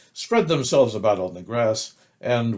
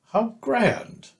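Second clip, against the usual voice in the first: high, delighted exclamation; delighted Pooh; 12 semitones higher than usual. A narrator's reading. Pooh thrilled about his party, high and delighted. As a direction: squeal it with joy.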